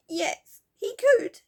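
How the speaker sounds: high, loud sobbing little fairy. Acted. Silky sobs to the children about Moonface, high and tearful. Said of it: "said Silky".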